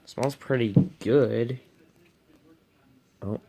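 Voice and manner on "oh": ordinary talk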